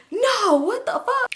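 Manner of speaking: high, slow, drawn-out gasp of disbelief; shocked disbelief. Retold or imitated. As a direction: gasp then stretch out the words in shock. Friend shocked at the news.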